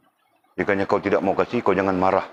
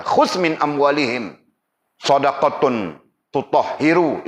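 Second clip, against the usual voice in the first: slow, raised chanting of the verse; Quran recitation; 6 semitones higher, slower than usual. Acted. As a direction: recite it slowly like in prayer. (bacaan ayat perlahan)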